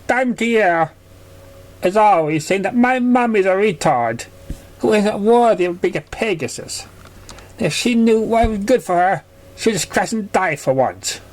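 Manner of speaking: put-on high, childlike and hurt; high young filly voice, a bit sulky; little filly voice. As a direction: play the small filly telling on a bully.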